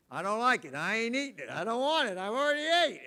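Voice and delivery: put-on high, loud fussy child refusing food; whiny picky kid. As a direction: whine like a kid pushing the plate away. Kids refusing food at dinner.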